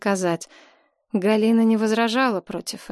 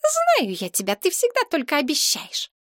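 put-on high, disbelieving girlfriend; hissed retort; 8 semitones higher than usual. A narrator's reading. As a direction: throw it back scornfully in a raised voice. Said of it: "прошипела девица". (язвительно, высоким девичьим голосом)

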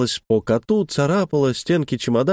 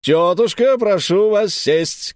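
shouted command; 7 semitones higher, slower than usual. A narrator's reading. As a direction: call the order across the stage. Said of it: "крикнул хозяин".

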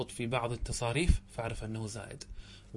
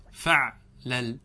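"فعلل" very slowly, in two separate pieces; broken into syllables; 4 dB louder, much slower than usual. Shown as a demonstration. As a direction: pause between the two halves of "فعلل". Teacher repeats pattern split in two. (تقطيع "فعلل" ببطء شديد)

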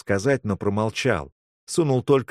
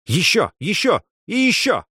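rising higher with each cry; urgent commands; 10 semitones higher, 4 dB louder than usual. Acted. Rescuer shocking the patient again, urgent commands.

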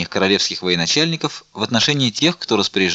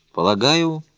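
higher, measured opening word; dignified; 6 semitones higher, slower than usual. A narrator's reading. Porthos begins his answer to the officer, dignified. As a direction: say it with self-possessed dignity. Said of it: "с достоинством проговорил Портос".

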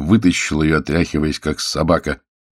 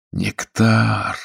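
higher, drawn-out sigh; sighing admiration; 4 semitones higher than usual. A narrator's reading. Investigator hears about the moonshine, sighing with admiration. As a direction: sigh the word out longingly. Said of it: "вздохнул Тыльнер".

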